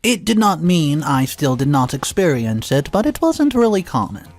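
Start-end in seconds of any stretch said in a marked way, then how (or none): none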